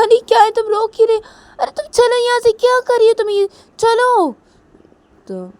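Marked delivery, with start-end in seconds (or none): 0.0-4.3 very high, loud angry shriek; furious shouting. Acted. Piu ordering a crying Rui out, furious shouting. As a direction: yell in exasperation to clear her out.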